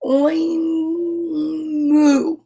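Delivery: very drawn-out "Oi" gliding into "moo"; pig trying again. Acted. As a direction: struggle through a long oink that becomes a moo. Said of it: "tried again".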